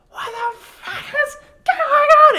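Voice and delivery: high, loud, slow panicked yell, laughing; panicked shout. Retold or imitated. Roommate woken in his undies, high laughing panicked yell. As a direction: scream it in a mock drunken panic. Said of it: "And he goes".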